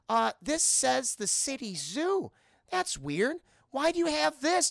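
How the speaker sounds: hesitant and slow, rising into the question; lightly voiced suspicious customer; puzzled questioning. Olive finds a zoo envelope, puzzled and suspicious.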